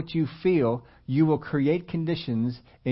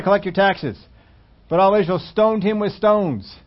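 louder, driving preacher's delivery; preaching at full force; 3 semitones higher, 7 dB louder than usual. Acted. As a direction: build the story like a pulpit climax. Preaching the Rehoboam story.

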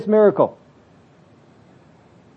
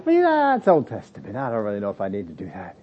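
dismissive excuse-maker; 8 semitones lower, 5 dB quieter than usual. Acted. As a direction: imitate someone shrugging off a rule. Voicing excuse-maker shrugging off tithe.

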